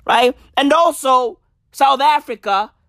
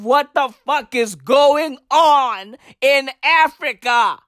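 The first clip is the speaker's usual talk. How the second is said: slow exasperated question; 2 semitones higher than usual. Acted. Exasperated closing rant.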